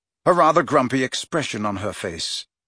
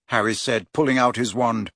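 ordinary delivery

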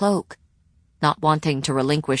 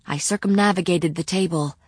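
ordinary delivery, nothing marked

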